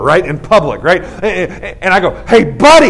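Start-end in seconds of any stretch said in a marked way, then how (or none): 2.3-2.9 shouted challenge across the store; loud confrontational hey buddy; loud call-out. Retold or imitated. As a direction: call him out loudly. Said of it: "just that loud".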